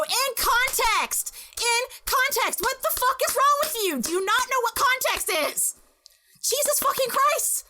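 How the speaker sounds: hammed-up furious rant at full pitch; outraged shouting. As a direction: shriek each phrase in comic indignation. Acting out furious retort to attacker.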